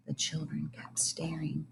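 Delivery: soft, eerie whisper. A narrator's reading. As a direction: let the silence creep in. Eerie silent classroom.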